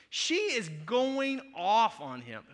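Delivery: usual speaking voice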